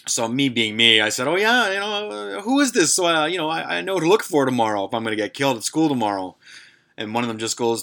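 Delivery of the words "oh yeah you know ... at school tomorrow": mock bravado. Mocking reply to prank callers.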